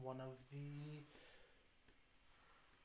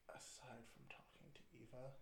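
whispering; 9 dB quieter than usual. Real, not acted. Confiding aside to the GM.

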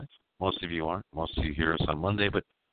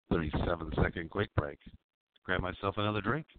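ordinary talk

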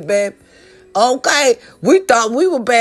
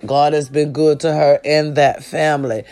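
sincere condolences; 6 semitones lower than usual. Real, not acted. Condolences to family after a cousin passed, low and sincere. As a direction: speak gently and low to the bereaved.

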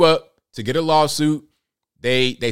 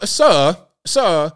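mock British accent, higher and louder; raised-pitch imitation of fake British; attempted British accent; 5 semitones higher, 5 dB louder, slower than usual. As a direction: mimic a dubious stab at a British accent. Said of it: "her attempt at making the British accent".

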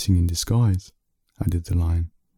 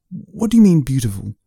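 sharp, much higher burst on what do you mean; impatient; 6 semitones higher, 4 dB louder than usual. Acted. Man snapping at the lion's calm remark, impatient. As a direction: demand an answer, exasperated. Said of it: "asked the man impatiently".

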